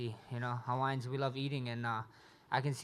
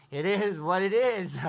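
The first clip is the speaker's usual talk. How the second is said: laughing it out, slower; chuckling through the words; 5 semitones higher, 6 dB louder, slower than usual. Real, not acted. Laughing at beaten opponent.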